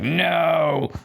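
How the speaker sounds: mock Loomis denial; Loomis-style no. Mom refusing Todd like Loomis, hammed-up no. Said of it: "almost like a Loomis".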